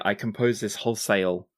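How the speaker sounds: ordinary talk, nothing marked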